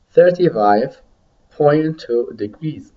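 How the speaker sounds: slow, pausing after "Thirty-five"; slow repeat model. Shown as a demonstration. Teacher models a temperature for students to repeat, slowly. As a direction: draw out "point two degrees" at half speed for learners. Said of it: "listen to me and repeat".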